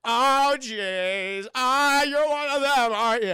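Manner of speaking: loud, drawn-out mock American accent; hammed-up impression of a bad American accent; goofy American accent. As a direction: overdo a fake American accent, high and slow. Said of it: "attempts to do an American accent".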